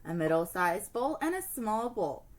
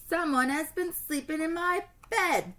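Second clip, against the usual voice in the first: bellow; 7 semitones higher, 3 dB louder than usual. Acted. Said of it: "Papa Bear bellowed".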